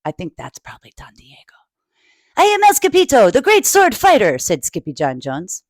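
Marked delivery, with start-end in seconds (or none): 0.1-1.6 whisper. Real, not acted. Whispered aside to listeners. 2.4-4.4 higher, bold little sword fighter; proud swashbuckling pup announcing himself; storybook hero voice, pitched up; hero dog voice. Acted.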